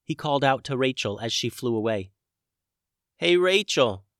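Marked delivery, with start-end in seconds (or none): 3.2-4.1 calling out. A narrator's reading. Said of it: "he yelled".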